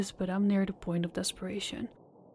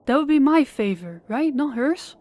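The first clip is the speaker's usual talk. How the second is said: louder, higher questioning; raised voice bargaining for his girlfriend; urgent demand; 5 semitones higher, 7 dB louder than usual. Acted. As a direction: press the lender hard.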